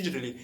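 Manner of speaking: "Didgeridoo" quick and soft, as didgerly; mastered pronunciation. Shown as a demonstration. Learner proving hours of practice paid off, fluent rapid pronunciation. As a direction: toss off "Didgeridoo" as a fluent digitally. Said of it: "eu consegui falar perfeitamente".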